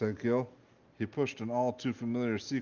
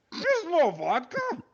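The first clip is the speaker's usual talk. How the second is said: even higher, louder, slow anguished Russian wail; guard repeating his grievance, louder, in a Russian accent; outraged Russian accent; 6 dB louder, slower than usual. Acted.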